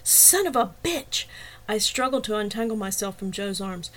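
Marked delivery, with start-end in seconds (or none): 0.0-1.2 high, slow angry shout. A narrator's reading. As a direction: yell it as you burst in. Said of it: "flew into the room shouting".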